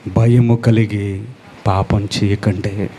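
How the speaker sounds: preacher quoting God's warning not to sin. Acted. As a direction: speak as God giving a grave warning. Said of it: "అందుకే దేవుడు అంటున్నాడు".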